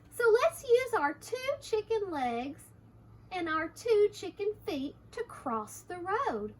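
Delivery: high, lively rallying character; eager storybook chicken. Acted. Hector urges Harriet into the river, eagerly. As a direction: propose an adventure, pitch lifted and swinging. Reads the chickens' plan to children.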